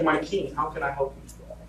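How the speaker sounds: soft, meek plea to the king; bow low and offer help quietly; humble hush. Retold or imitated. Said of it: "He was humble in what he was doing".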